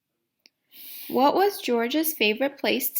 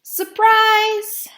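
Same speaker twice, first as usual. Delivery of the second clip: a big high shout of surprise; excited surprise; 10 semitones higher, 10 dB louder than usual. Acted. The man surprises George at the quarry with a shout. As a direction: burst out with it. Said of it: "George's friend said".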